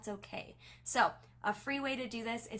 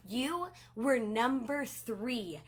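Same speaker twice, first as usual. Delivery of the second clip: much higher and louder, slow and emphatic on number three; excited shout out; 6 semitones higher, 7 dB louder than usual. Shown as a demonstration. Leader telling a consultant her sales rank, thrilled and loud. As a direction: cheer like she just won. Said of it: "I share that excitement".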